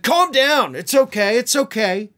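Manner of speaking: snapping "calm down". Retold or imitated. Host imitating Comer snapping at a reporter, mildly mocking. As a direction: bark "Calm down" at him, then brush him off with "It's okay". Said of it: "Comer snapped back".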